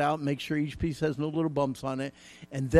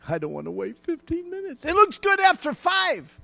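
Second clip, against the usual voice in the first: whiny and impatient, much higher on the second line; impatient whine; 9 dB louder than usual. Retold or imitated. Husband building a model, whining impatiently at the wait. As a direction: whine like a kid who can't wait.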